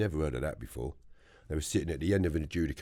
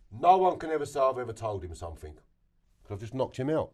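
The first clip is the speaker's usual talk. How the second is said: raised-pitch taunt, retold; defiant declaration; 7 semitones higher, 4 dB louder than usual. Inmate leaning over the man he knocked out, defiant.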